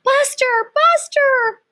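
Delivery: high, loud frantic scream; teenage girl screaming for her dog. Acted. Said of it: "I heard a scream".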